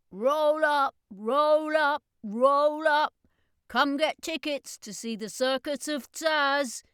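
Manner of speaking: put-on loud, high boy's street cry; shouting. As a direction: bellow it like a circus barker selling tickets. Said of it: "the young boy shouted".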